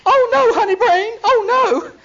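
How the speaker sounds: high, loud hammed-up squeal; mock panic. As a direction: wail in fake dismay. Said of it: "it's like".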